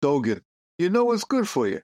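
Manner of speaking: a New York mobster leaning on a monk; New York mobster accent. Pretend. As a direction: lean on the New York wise-guy accent like a shakedown. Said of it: "not appreciating our accents".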